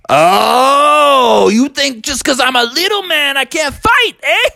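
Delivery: high, loud, prickly bird voice; a tiny bird picking a fight; touchy woodpecker. Acted. The woodpecker challenges Bubby, touchy and high.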